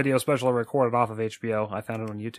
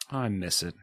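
ordinary talk, nothing marked

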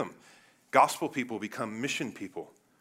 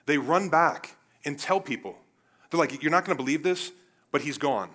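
raised, higher-pitched preacher's voice; forceful preaching; 5 semitones higher, 8 dB louder than usual. Real, not acted. Preacher retelling the resurrection to church, forceful preaching. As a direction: preach it out loud and high.

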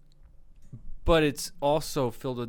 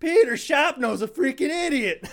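high, louder imitation of a ranting teacher; mock angry yell; 11 semitones higher, 5 dB louder than usual. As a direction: replay the teacher's rant, a touch hammed-up. Retelling the teacher's outburst again.

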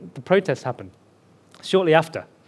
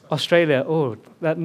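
ordinary talk

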